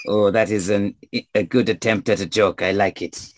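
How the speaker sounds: put-on French accent. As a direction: approve mildly with a French accent. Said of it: "stranger with a French accent".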